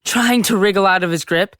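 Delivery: normal talking voice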